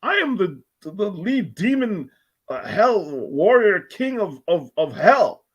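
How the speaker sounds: lightly voiced boastful demon king; megalomaniac rant. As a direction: puff up like a hell king. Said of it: "be like".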